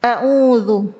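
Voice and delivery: a'udzu with a thick, heavy hamzah; a'udzu said with the hamzah made heavy, the wrong way. Shown as a demonstration. Teacher demonstrating the wrong heavy hamzah. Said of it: "menebalkan huruf hamzah pada".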